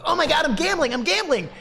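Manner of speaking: high, loud, hammed-up excited squeal; mocking an overexcited gambling streamer; mock gambler.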